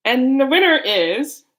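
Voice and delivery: loud, high drumroll reveal; mock award announcer. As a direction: announce it like a contest result. Mock announcement before card reveal.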